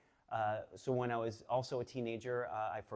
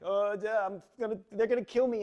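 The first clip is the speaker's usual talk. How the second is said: higher, louder panicked outburst; 10 semitones higher, 9 dB louder than usual. Retold or imitated. Teen after crashing mom's car, panicked higher cry. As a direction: blurt it out in a mock panic. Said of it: "and I'm freaking out".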